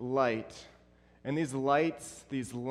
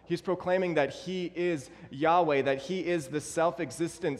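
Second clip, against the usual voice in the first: preaching climax; 3 semitones higher than usual. Acted. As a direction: build it like a pulpit crescendo.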